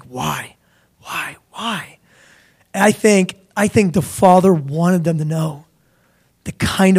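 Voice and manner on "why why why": very soft, musing repeated question; hushed wondering. Acted. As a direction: murmur it softly, as if wondering to yourself.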